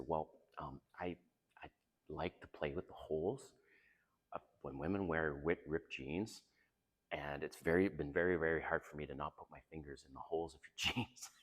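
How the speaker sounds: low, soft, halting admission; coy confession. Acted. Man confessing a kink to his date, coy. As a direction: confess quietly with hesitant pauses.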